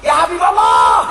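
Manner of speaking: loud, gruff mock-wife retort; harsh reply. Unwilling wife answering her husband's call, harsh shouted imitation. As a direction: shout it back curtly. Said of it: "tapi lah jawabannya atos".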